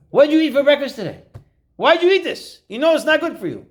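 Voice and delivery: high, loud nagging voice; scolding lecture. Acted. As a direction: nag like a talking car giving moral reproof. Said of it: "He starts giving you musar".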